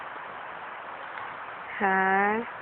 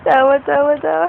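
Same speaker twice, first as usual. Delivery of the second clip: loud, higher playful urging; playful coaxing; 3 semitones higher, 8 dB louder than usual. Real, not acted. Mother urges baby to laugh, loud playful coaxing.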